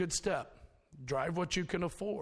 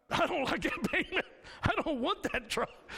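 laughing; 7 semitones higher than usual. Retold or imitated. Laughing while retelling his prayer.

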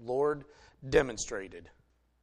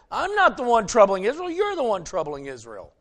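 loud, higher comeback, then settling; defiant retort; 6 semitones higher, 8 dB louder than usual. Retold or imitated. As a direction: fire the accusation back. Elijah retorts to the king.

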